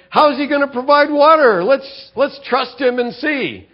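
high, loud and eager, full of faith; hopeful believer, bright and loud; eager trusting praise. Pretend. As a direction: sing out your trust in God. Imagined faithful Israelites trusting God.

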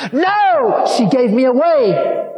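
a very high, loud shrieking no; demon's shriek. Retold or imitated. The spirit inside the woman refusing, a loud high shriek. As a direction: scream it like the spirit inside her. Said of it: "this voice came out of her".

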